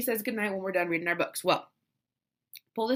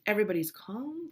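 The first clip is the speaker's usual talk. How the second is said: usual speaking voice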